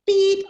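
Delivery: loud, high bleeped-out curse; a bleep in place of a swear; high mock beep. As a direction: censor yourself with a beep. Exasperated at being dragged back.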